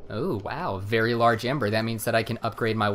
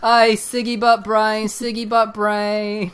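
high, drawn-out mock-British jeer; loud put-on British heckle; British accent; 8 semitones higher, 5 dB louder, slower than usual. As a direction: taunt slowly in a hammy British accent. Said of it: "my favorite British-ism".

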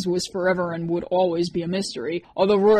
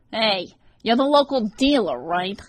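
sly, nasal stranger, pitched up; dark nasal snicker; 4 semitones higher, 5 dB louder than usual. A narrator's reading. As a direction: sneer it through the nose. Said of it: "a dark, nasally voice snickered".

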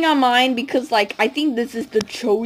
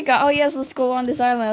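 usual speaking voice

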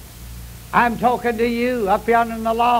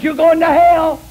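angry retort; 8 semitones higher, 7 dB louder than usual. Retold or imitated. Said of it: "But he got mad".

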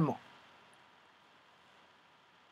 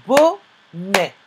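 "bonnet" broken in two, BO... NNET; syllable by syllable; 5 dB louder than usual. Shown as a demonstration. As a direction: split "bonnet" into two slow, separate syllables. Teacher splits word into syllables. (« bonnet » découpé en syllabes)

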